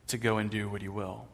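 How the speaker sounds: ordinary talk, nothing marked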